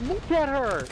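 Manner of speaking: high, loud mock yell across the bar; imitating a friend screaming to look at a drunk girl; retold scream. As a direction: yell it like your buddy pointing at her.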